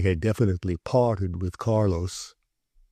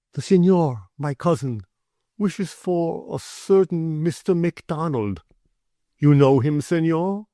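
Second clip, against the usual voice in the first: put-on higher, eager young gentleman; raised Carlos voice; 7 semitones higher than usual. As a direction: lift the voice, lively and courteous. Carlos speaks to mate.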